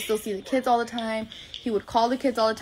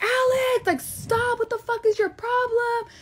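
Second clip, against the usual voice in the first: put-on shrieking stop at the boyfriend, pitched way up; screaming at him; 13 semitones higher, slower than usual. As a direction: yell it at him in a panic, up high. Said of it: "I was screaming".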